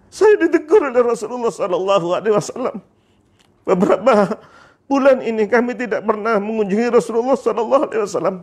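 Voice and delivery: high, plaintive confession; tearful fear. Acted. Bilal confessing the reproach to his family, tearfully afraid. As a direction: plead it out as if crying. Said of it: "Saya takut, saya takut".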